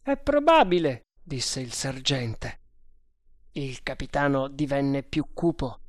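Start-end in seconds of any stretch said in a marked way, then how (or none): 0.0-1.1 high-pitched, emphatic sergeant; sergeant's reply. A narrator's reading. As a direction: answer high, then drop at the end. Voce del sergente Bharata nel dialogo. (voce acuta da sergente)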